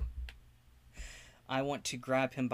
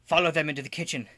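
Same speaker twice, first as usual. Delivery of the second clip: urgent order; 3 semitones higher, 9 dB louder, much faster than usual. Acted. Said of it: "And say".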